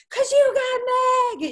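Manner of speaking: very high, loud and slow happy yell; radio host shouting praise at his co-host; yelling into the phone. Retold or imitated. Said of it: "yelling it into the phone".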